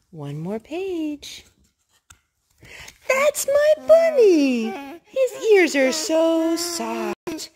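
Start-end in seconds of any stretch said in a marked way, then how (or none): 3.1-7.5 high, loud delighted cry; excited discovery. Acted. Mother reading the board book to her baby, thrilled. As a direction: burst out with joy at finding him. Found the right bunny in book.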